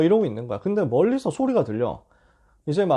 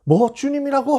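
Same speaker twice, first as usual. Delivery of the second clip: high, loud surprised cry; startled disbelief; 10 semitones higher, 5 dB louder than usual. Retold or imitated. Peter hearing the Lord is on the shore, high loud surprise. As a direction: jolt up in shock at the news.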